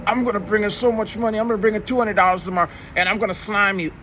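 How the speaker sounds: put-on high, fast cocky kid voice; bragging student. Student bragging he'll slime the teacher, fast high cocky kid voice. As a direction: rattle it off like a boastful eleven-year-old. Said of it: "And he says".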